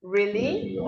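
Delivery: "Really" climbing high on the last syllable; expressive rising "Really?"; rising intonation. Shown as a demonstration. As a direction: let "Really" swing upward as a question. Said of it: "your voice goes high".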